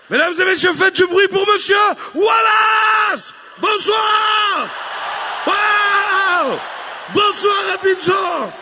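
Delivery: loud high emcee shout; hype announcer. Acted. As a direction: work up the crowd like an emcee. Announcer hyping the entrance. (annonceur de gala qui crie)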